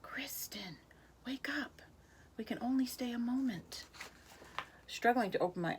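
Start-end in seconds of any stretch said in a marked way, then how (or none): 0.0-3.9 soft, higher airy fairy voice; a faint magical voice waking her; a fairy gently waking a sleeping girl, soft and airy. A narrator's reading. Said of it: "the breeze seemed to whisper in my ear".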